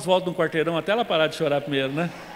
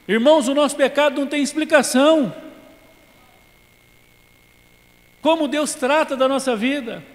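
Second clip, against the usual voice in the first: high and loud proclamation; preacher driving the lesson to the church; forceful preaching; 7 semitones higher, 5 dB louder than usual. Real, not acted.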